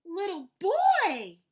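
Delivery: high, loud, slow call; big slow excited shout; shouted greeting. Acted. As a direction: call out to the boy in surprise. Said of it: "Amu shouted".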